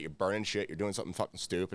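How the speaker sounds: normal talking voice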